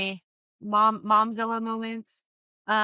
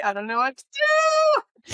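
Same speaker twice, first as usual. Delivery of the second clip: very high, slow, louder panicked squeak; mock panic; 7 dB louder, slower than usual. Planner retelling her panic at the rehearsal, high squeal. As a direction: squeal it like you're totally lost. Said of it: "it's like".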